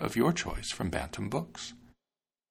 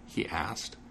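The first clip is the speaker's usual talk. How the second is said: ordinary delivery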